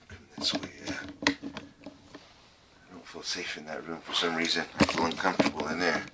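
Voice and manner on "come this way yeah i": hushed unvoiced whisper; breathy hushed whisper. Real, not acted. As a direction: whisper so nothing hears you. Whispering while ghost hunting.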